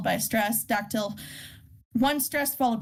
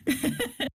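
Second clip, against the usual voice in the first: short real laugh, higher pitched; quick chuckle; amused laugh; 5 semitones higher than usual. Real, not acted. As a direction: let out a brief amused laugh. Amused reaction during the lesson.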